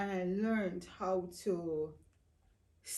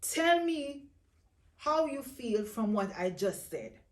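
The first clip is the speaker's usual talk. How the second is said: loud, high-pitched urging to open up; forceful retold commands, much louder; insistent husband; 9 semitones higher, 9 dB louder, slower than usual. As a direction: push hard for an answer, imitating an insistent husband. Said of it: "he would insist".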